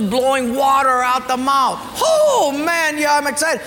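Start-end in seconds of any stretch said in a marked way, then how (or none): 2.0-3.6 excited cry. Acted. The man hearing of porpoise fountains cries out, excited. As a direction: gasp at the porpoises, then gush. Acted rich gift recipient.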